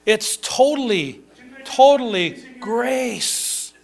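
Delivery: TOTALLY drawn out slowly, grace lifted high; slow emphatic stress. Real, not acted. Preacher to congregation, stretching out totally grace. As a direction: lean hard on totally, totally, slowly.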